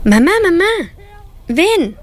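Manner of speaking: put-on high, urgent childlike begging; pleading girls. A narrator's reading. Girls begging mother from the kitchen doorway, pleading. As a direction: call mom high and pleading. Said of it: "le rogaron".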